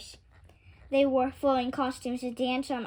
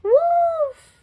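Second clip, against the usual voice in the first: very high, loud alarm cry; 12 dB louder than usual. A narrator's reading. A boy at the rink cries out in alarm at the wolf. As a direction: shout the warning. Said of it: "A boy cried".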